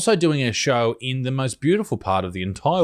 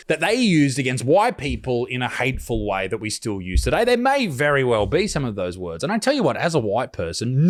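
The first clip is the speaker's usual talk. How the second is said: ordinary talk, nothing marked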